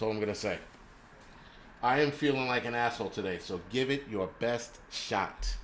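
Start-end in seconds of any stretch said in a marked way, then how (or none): 3.4-5.6 slow and spaced out, a touch lower; measured, unhurried challenge; slow, deliberate dare. Real, not acted. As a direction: draw out each word as a dare. Daring his critic, drawn out.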